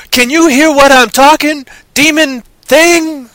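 loud, high hammed-up movie-star yell; Samuel Jackson impression. Host casting a remake, loud mock star yell. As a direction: shout it high, dragging out the last words. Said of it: "Mutter Truck and Samuel Jackson".

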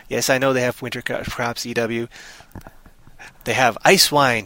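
ordinary talk

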